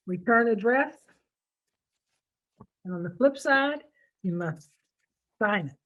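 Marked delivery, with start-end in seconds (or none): none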